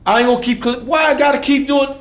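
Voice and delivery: loud, high, impassioned; fired-up preaching. Real, not acted. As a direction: preach it at full force. Preaching about staying a boy.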